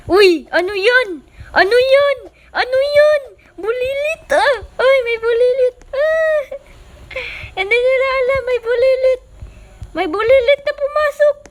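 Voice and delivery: very high, loud alarmed yelling; panicked shriek. Acted. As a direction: shriek at the intruder. Voices NPCs panicking at the intruder.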